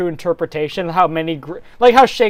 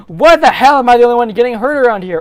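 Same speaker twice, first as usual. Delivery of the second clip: indignant sarcastic hero; indignant retort; 5 semitones higher, 5 dB louder than usual. Acted. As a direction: snap back at the princess. Link snapping back at Zelda.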